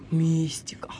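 mysterious whisper; spooky whisper. Pretend. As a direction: breathe the word mysteriously. Mocking the word mystery.

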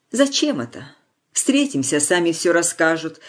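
usual speaking voice

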